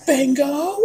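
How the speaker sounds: high, excited shout of Bingo. Retold or imitated. Newcomer wrongly shouting out a win at bingo, high excited call. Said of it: "Then I shouted".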